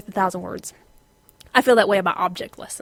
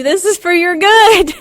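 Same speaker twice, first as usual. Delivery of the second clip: higher, louder exasperated; exasperated scold; 9 semitones higher, 10 dB louder than usual. Retold or imitated. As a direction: snap it at the dogs. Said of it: "all the while I'm going".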